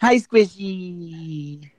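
slow, soft, cooing. Real, not acted. Greeting the baby softly, tender baby talk.

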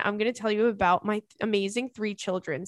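ordinary talk, nothing marked

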